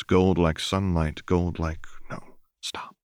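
ordinary delivery, nothing marked

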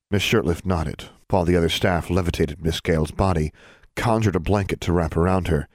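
plain reading voice

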